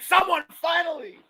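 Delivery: high, loud delighted yell; excited shout. Retold or imitated. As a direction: burst out like it finally happened. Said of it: "I'm like".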